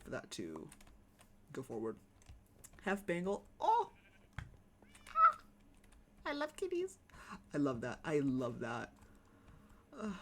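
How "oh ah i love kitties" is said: high, squeaky cutesy voice. Pretend. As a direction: gush in a tiny high voice. Squeaky voice gushing over kitties.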